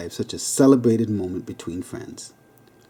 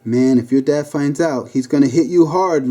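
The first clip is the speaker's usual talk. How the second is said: plain reading voice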